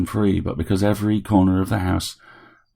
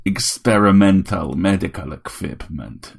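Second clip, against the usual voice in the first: ordinary delivery, nothing marked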